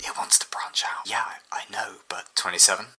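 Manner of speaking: hushed whisper between scientists; whispered aside. Acted. Scientist whispering to colleague about the AI. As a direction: keep it from the AI.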